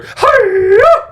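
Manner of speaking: high squealing Miss Piggy giggle; a quick high pig-lady laugh. Pretend. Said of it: "That's a Miss Piggy".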